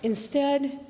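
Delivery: ordinary talk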